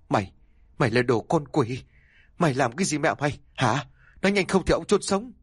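fast, high, savage threat to his daughter; furious threat. Acted. Father threatening his daughter, furious and fast. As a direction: spit it out like a wild beast. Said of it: "bộc phát lên con thú hoang".

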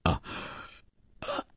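faint, whispered, broken apology; weak stammer. Acted. Guest coming round apologises to his host, weak stammer. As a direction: barely voice it, halting on each breath. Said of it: "克里斯朵夫结结巴巴地说着".

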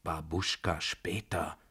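hushed, slow murmur; whispered aside. A narrator's reading. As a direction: discreetly shush her under your breath. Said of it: "flüsterte ich".

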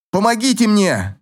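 high panicked cry for help; shouting for help. A narrator's reading. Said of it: "закричал я".

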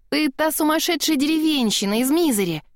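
excited outburst; exclaiming. Acted. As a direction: call it out with sudden recognition. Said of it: "воскликнула Мисти".

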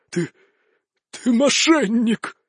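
slow, strained accusation, broken by a pause; hoarse gasp of a mortally wounded man; dying wheeze. A narrator's reading. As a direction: croak it out haltingly while collapsing. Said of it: "прохрипел он, падая на колени".

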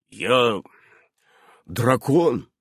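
understated gloomy admission; glum, contained statement. A narrator's reading. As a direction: pronounce ДРАКОН grimly, voice falling. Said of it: "мрачно изрёк он".

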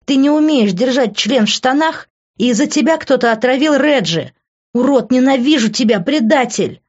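high, loud woman's tirade; shrieked rage at a betrayer; accusing scream. A narrator's reading. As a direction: hurl accusations in a rising, furious voice. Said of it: "закричала она".

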